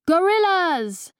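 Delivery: high, slow comic Peruvian shout; hammed-up Peruvian punchline. Helena delivering the stage punchline in fake Peruvian accent. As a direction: land the gag in a put-on Peruvian accent. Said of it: "talk in ... Peruvian accent".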